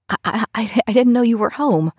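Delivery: nervous stammer, voice cracking. A narrator's reading. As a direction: stammer it out, voice breaking. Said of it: "my voice cracking".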